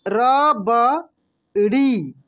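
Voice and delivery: Rabri stretched very slowly, each syllable drawn out. Shown as a demonstration. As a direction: spell rabri out slowly for the class. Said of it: "कैसे पढ़ेंगे हम लोग?". (रबड़ी को धीरे धीरे खींचकर बोलिए)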